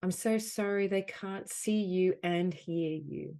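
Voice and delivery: projected, emphatic apology phrases with long pauses; guided healing affirmation. Acted. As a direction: offer each phrase firmly to be felt.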